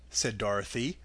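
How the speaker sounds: ordinary delivery, nothing marked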